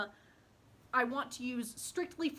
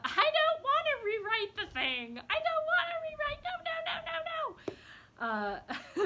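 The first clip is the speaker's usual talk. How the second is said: put-on much higher, whiny toddler wail; toddler tantrum; 5 dB louder than usual. Inner child refusing to rewrite her draft, high whiny tantrum. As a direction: pitch it up and whine like a toddler refusing. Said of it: "throwing a toddler temper tantrum".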